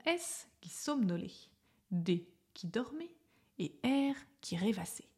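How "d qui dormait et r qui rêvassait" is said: plain reading voice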